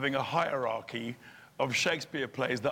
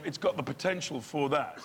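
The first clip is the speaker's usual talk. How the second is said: ordinary delivery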